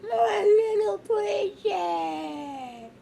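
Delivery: very slow, high tearful wail; drawn-out emotional cry. Acted. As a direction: stretch it out, overcome with love. Mother overcome with emotion.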